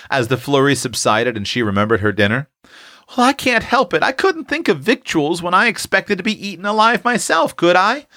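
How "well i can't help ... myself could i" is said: put-on high, plaintive girlish excuse; pleading. A narrator's reading. Prue excuses herself to scolding sister, high pleading. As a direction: plead your case in a much higher voice. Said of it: "pleaded poor Prue".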